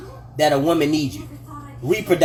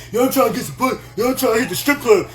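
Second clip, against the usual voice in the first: raised, loose party-bro impression; party-boy bro voice; 5 semitones higher, faster than usual. Talking to real men, mocking the club-chasing guys in a raised bro voice. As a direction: play a horny guy hyped for the club.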